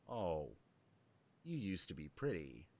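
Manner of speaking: soft, low pity for a faded beauty; pitying sigh. Retold or imitated. To a coke fiend, a low pitying murmur. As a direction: murmur it like a sad condolence. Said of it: "I don't know how to say it".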